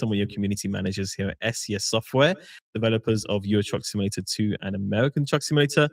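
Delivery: usual speaking voice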